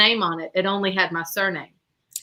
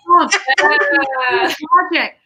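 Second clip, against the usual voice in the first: higher, louder protest; exasperated protest; 6 semitones higher, 3 dB louder than usual. Retold or imitated. Daughter protesting to mom that it was her project, higher and louder. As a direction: explain it in disbelief. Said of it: "I'm like".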